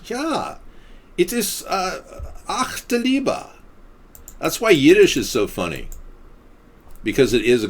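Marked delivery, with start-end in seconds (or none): 0.0-3.5 high-pitched comic German accent; hammed-up German accent with halting pauses. Shown as a demonstration. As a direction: play up a silly German accent, voice way up. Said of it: "the German accent".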